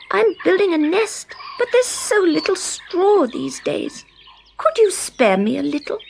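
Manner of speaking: high, bright little bird voice; chirpy Blackbird. Acted. Blackbird asks Scarecrow for straw, high and chirpy. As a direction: play the blackbird asking a favour.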